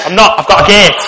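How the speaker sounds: loud northern English warrior; northern accent. Acted. Imagined warrior showing off his gate, northern accent. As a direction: announce it proudly in a mock northern accent. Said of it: "I could just see a massive northerner".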